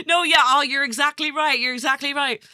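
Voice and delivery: high, loud, laughing bluff; panicked fake agreement; faked agreement. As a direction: bluff enthusiastically down the phone. Faking understanding on phone.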